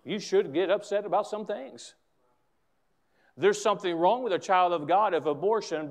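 ordinary delivery

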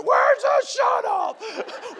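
high, loud, drawn-out shout; mock panic shout. Everyone at the pastor's leaking sink, high loud panic. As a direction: yell it slowly in a flood panic.

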